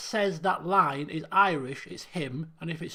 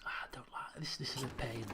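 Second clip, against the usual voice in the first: very soft, low grumble under the breath; muttered grumble; 7 semitones lower, 14 dB quieter than usual. Real, not acted. Player to himself about a tedious task, muttered grumble. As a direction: grumble quietly to yourself.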